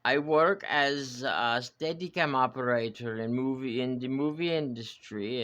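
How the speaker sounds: put-on half-hearted French accent introducing himself. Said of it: "Maybe I'm sounding more German".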